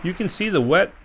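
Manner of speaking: ordinary delivery, nothing marked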